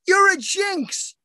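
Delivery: high, slow deadpan accusation; mock accusation. Son thinking his mother is a jinx, high and slow. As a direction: drag it out as a blunt verdict.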